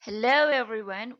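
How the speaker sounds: loud cheerful hello to viewers; bright channel greeting. Real, not acted. (sapaan ceria yang keras)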